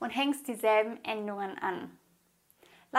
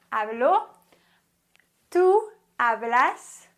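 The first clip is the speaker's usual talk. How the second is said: slow, louder model of "tú hablas"; langsam vorgesprochen; 5 dB louder than usual. Shown as a demonstration. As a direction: stretch "tú hablas" out slowly, a bit louder. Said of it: "Okay, nochmal". (langsam und lauter vorsprechen)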